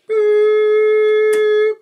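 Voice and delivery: high, loud, long held boops; high beep tones. Acted. Maker imitating the beep on the recorded message. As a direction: hold each boop like a machine tone.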